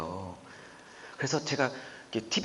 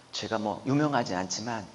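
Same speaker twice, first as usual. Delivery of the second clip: ordinary delivery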